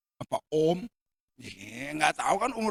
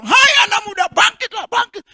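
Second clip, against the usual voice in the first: high, loud call to rise; commanding shout; 12 dB louder than usual. Acted. Jesus shouting at the dead youth to rise, commanding.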